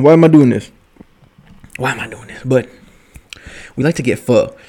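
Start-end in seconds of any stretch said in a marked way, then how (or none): 0.0-0.7 louder, half-shouted self-question; exasperated outburst at his own late-night drinking; loud exasperation. Real, not acted. Asks himself at two AM. 1.8-2.4 much softer, muttered to himself; quietly asking himself again; quiet repeat. Real, not acted.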